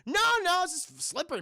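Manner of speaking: high, loud mock denial; flustered cover-up. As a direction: deny it too quickly and too high. Character covers up his fright.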